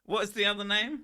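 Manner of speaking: bad German accent. Streamer asking his friend mid-skit, mock German accent. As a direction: ask it in the same silly German voice. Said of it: "His German accent is terrible".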